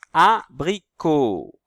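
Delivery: slow, loud word split into syllables; drawn-out, emphatic dictation of each syllable. Shown as a demonstration. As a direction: sound it out slowly for beginning readers. Teacher sounding out syllables.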